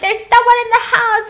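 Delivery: high, loud acted alarm; panicked. Acting Claire in an anxiety attack, panicked. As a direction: cry it out high in mock panic. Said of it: "she's having an anxiety attack".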